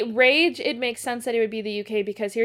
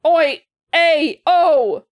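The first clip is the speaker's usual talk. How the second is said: hammed-up Italian, much higher and louder; Italian accent; 6 semitones higher, 11 dB louder, much slower than usual. Friend switches from British to Italian, mock Italian accent. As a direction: play a loud cartoon Italian. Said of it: "I'm Italian now".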